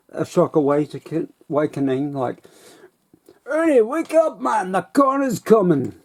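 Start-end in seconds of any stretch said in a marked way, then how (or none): 3.5-5.9 put-on much higher, Jamaican accent drifting into Irish; Jamaican slipping into Irish. A bus passenger waking Ernie, Jamaican-Irish accent. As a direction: start Jamaican and slide into Irish. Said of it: "he doesn't sound like an Irishman, he sounds like a Jamaican".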